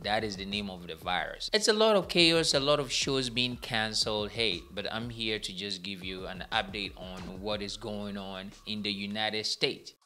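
usual speaking voice

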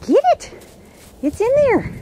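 very high-pitched egging on; excited urging. Real, not acted. Owner sending the dog to fetch, excitedly urging. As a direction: urge the pup on in a high voice.

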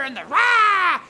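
a loud growling storm roar; mock roar. Storm sound for the church kids, roaring.

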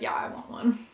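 whispered, eager yes; whispered reply. Retold or imitated. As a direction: whisper the answer back. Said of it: "I'd be like".

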